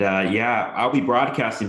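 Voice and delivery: normal talking voice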